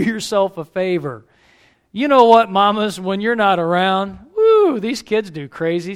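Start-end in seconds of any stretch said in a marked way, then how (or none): none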